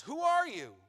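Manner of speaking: put-on high, loud old father's challenge; an old man demanding to know who is there; old Isaac. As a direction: bark it out as the suspicious blind father. Blind Isaac voiced in the story.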